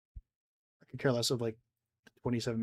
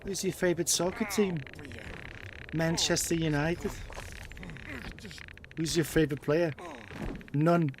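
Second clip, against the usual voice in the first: put-on British accent; 4 semitones higher than usual. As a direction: play a cheeky British football fan. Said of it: "doing a British accent".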